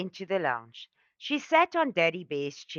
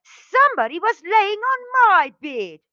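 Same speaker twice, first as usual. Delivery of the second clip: much higher, louder shrieking bear voice; Mommy Bear roar; 10 dB louder than usual. A narrator's reading. Mommy Bear finds her bed used, roaring high and loud.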